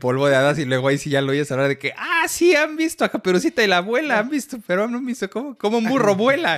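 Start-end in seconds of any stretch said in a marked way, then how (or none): 2.0-6.6 very high, loud cartoon voice; imitating the Donkey. Retelling the fairy dust scene, high and loud as the donkey. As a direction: crow it like the flying donkey.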